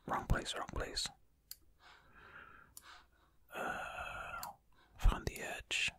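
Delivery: soft breathy whisper to the mic; hushed running commentary; ASMR whisper. Acted. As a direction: whisper close and intimate while solving. Whispered ASMR puzzle play.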